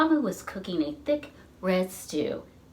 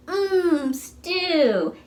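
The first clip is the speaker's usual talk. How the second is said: put-on higher, dreamy little boy sighing mmm stew; 7 semitones higher than usual. Little boy sighing to Amu about the stew, dreamy and high. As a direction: melt over the word stew like a hungry kid. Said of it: "he sighed".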